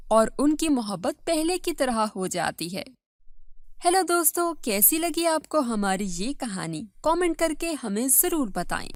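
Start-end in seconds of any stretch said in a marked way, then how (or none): none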